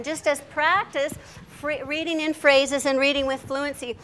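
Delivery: ordinary delivery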